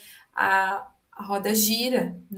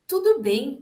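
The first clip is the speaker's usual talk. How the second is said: "tudo bem" higher and louder with a bright rise, brushing it off; falsely breezy reassurance; 5 semitones higher, 6 dB louder than usual. Shown as a demonstration. Doctor minimizing a patient's cancer, falsely cheerful. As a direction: wave the worry away with a chirpy "tudo bem". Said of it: "Evitar, minimizar ou mudar de assunto".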